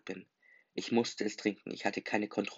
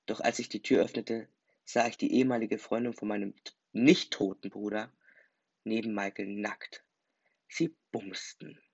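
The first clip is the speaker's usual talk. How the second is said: plain reading voice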